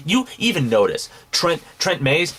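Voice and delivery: ordinary talk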